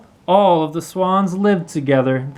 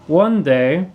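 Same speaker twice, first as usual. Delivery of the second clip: ordinary delivery, nothing marked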